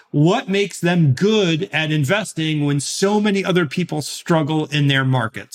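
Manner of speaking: ordinary talk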